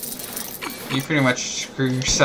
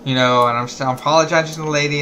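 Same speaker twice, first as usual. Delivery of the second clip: ordinary talk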